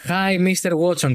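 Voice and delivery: put-on slow English greeting in a thick Greek accent. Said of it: "του είπα προχθές". (αγγλικά με βαριά ελληνική προφορά)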